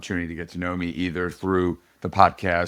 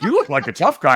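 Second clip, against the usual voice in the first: amused, higher and louder, half laughing; laughing quote of fans; 7 semitones higher, 6 dB louder than usual. Retold or imitated. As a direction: laugh while quoting the compliment. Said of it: "They're like".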